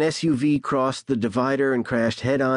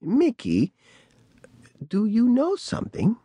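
put-on old woman voice; 11 semitones higher than usual. A narrator's reading. Old woman addresses Mickey.

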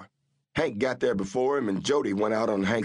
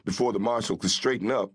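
ordinary delivery

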